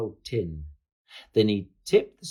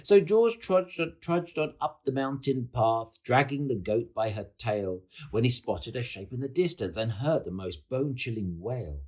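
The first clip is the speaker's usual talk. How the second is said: ordinary delivery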